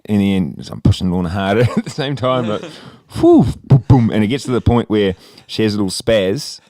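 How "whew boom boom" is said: whooshing swing noise, then booms; loud, high imitated whoosh; swing sound effects. Acting out the swing's motion.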